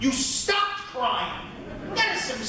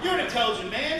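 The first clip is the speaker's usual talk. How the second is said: normal talking voice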